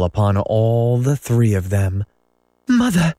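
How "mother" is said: high call of mother; crying out. A narrator's reading. Wendy calling to her mother from bed, high cry. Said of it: "Wendy cried".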